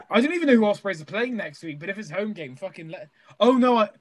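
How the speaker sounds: normal talking voice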